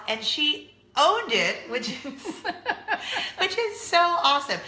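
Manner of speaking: gushing. Real, not acted. Slow far admiring.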